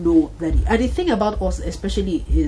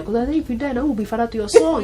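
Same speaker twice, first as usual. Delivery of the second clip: ordinary talk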